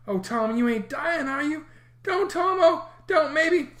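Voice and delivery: put-on high, frightened young boy; a scared kid begging his brother. A narrator's reading. Sid frightened.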